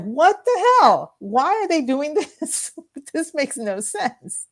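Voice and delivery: high, slow exasperated complaint; mock outrage. Young woman fumes at the drinking age rising again, slow and high. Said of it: "I was like".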